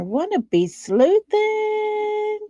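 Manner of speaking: high, slow sing-song tease; singsong. Pretend. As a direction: croon it playfully up high. Greeting viewers in a singsong voice.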